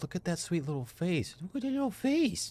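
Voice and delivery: put-on baby talk. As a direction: coo at it like a baby animal. Cooing at cute creature.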